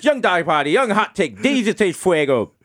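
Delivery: high, hammed-up announcer roll call; hype nickname intro. Host introducing himself by silly aliases, hyped up. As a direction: reel off the nicknames like a hype man.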